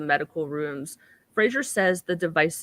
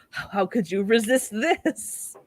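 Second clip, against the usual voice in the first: seductive; 3 semitones higher, slower than usual. Retold or imitated. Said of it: "To be like".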